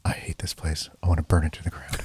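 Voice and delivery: hushed aside meant to be overheard; stage whisper. Acted. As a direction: say it under your breath but audible. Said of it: "It's called a stage whisper".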